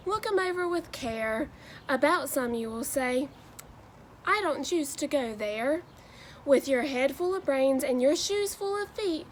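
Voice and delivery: lively, sing-song picture-book read-aloud; animated Dr. Seuss storytelling with rhyming bounce; reading to children. Acted. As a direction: read it like story time for a class of kids.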